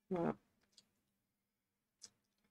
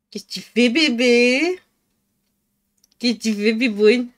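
affectionate pet voice; talking to a pet; 6 dB louder than usual. Real, not acted. Baby talk to the cat.

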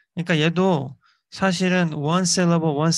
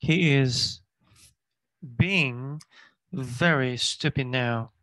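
very slow, every word clear; slow model reading; much slower than usual. Shown as a demonstration. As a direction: read it word by word for learners. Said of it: "teacher reading slowly".

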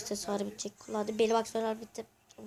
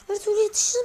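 higher and louder, rising at the end; exasperated outburst; 8 semitones higher, 5 dB louder, faster than usual. Real, not acted. Gamer moaning he can't catch up, higher and louder. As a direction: blurt it out in frustration. Repeats line in frustration.

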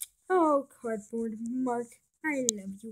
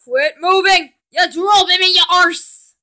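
loud, irritated yelling; annoyed shouting; 6 semitones higher, 14 dB louder than usual. Acted. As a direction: bark at him to stop. Shouting at squirming.

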